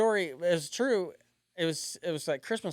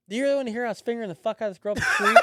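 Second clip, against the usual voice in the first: laughing; 8 semitones higher, 6 dB louder, much faster than usual. Retold or imitated. Blurting the truth to mom.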